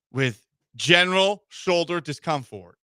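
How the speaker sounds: ordinary talk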